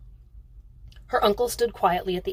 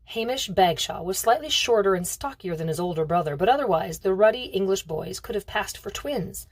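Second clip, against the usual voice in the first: usual speaking voice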